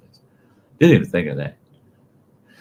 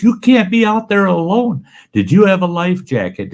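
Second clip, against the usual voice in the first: scolding, much higher and louder; riot act; 12 semitones higher, 7 dB louder than usual. Retold or imitated. Superintendent scolds the boy for fishing alone, loud angry scolding. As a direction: scold him like a furious camp boss. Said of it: "giving me the riot act".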